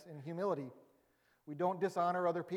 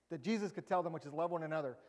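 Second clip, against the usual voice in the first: normal talking voice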